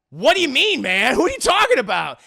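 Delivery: loud, high mock-incredulous outburst; acted bafflement. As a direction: demand an answer, loud and baffled. Armed lover baffled by husband.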